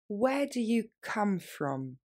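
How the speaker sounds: slow, deliberate model phrase; slowly. Shown as a demonstration. Teacher slowing down for French students. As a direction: stretch each word out for learners. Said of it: "plus lentement".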